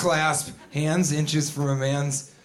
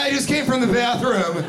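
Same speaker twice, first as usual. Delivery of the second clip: higher, retold nervous explanation; awkward over-explainer; 7 semitones higher than usual. Person explaining a wet handshake, awkwardly high. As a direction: explain the wet hand too eagerly. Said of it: "they're like".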